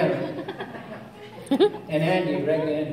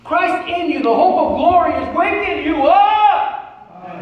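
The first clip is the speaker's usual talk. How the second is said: higher, loud, rhythmic preaching; full-force preaching; 8 semitones higher, 3 dB louder than usual. Acted. Preacher challenging the church why not healing, preaching. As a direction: hammer each why at the crowd.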